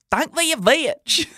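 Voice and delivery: high, squeaky and slow; mock his own squeaky teen voice; hammed-up squeaky kid voice. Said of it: "I can't have my voice sound like".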